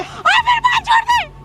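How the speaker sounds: high, slow, laughing shout. Acted. Wife yells at her husband as he hits her, shouting. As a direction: cry out high and drawn out, half laughing. Wife being beaten, crying out.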